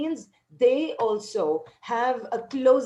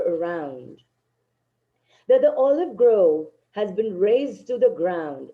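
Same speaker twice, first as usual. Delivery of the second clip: poem reading; 5 dB louder, slower than usual. Acted. Teacher reads the poem aloud.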